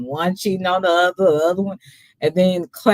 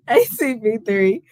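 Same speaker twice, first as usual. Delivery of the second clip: ordinary delivery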